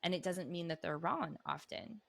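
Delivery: ordinary talk, nothing marked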